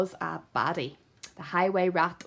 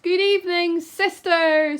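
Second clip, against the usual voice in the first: much higher, louder duck call; 10 semitones higher, 6 dB louder than usual. Acted. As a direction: call into the cave. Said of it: "She quacked".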